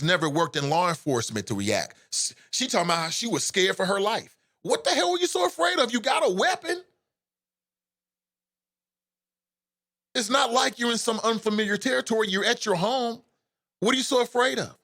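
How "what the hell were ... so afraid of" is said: real exasperated disbelief, voice up high; incredulous. Real, not acted. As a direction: pitch jumps much higher in disbelief. Incredulous rant at the officer.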